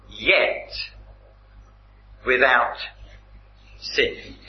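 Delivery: "Yet without sin" spaced out with long pauses between words; slow weighted phrase. Shown as a demonstration. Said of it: "important difference in those three words".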